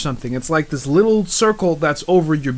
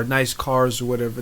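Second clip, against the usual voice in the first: ordinary talk, nothing marked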